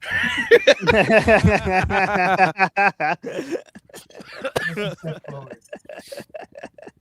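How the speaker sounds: long laugh, almost crying; laughing to tears. Real, not acted. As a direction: laugh till you cry. Friends cracking up at joke.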